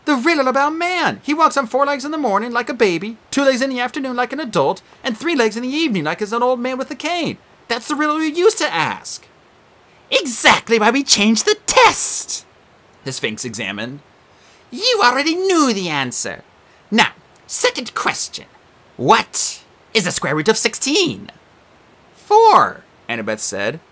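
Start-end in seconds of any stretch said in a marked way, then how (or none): 0.0-9.3 put-on girl's voice. Annabeth explains riddle. 10.1-12.4 high prim quizmaster creature; Sphinx voice. Acted. 14.7-21.3 high prim quizmaster creature; Sphinx voice. Acted. 22.1-22.8 put-on girl's voice. Annabeth answers quickly.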